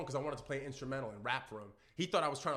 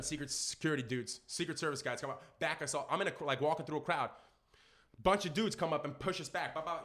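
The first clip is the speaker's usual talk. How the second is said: normal talking voice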